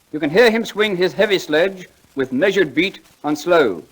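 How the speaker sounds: slow, lofty recitation; poem recital. Acted. [name] reciting Longfellow to the audience, slowly and grandly. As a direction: declaim each line like a school prize reciter.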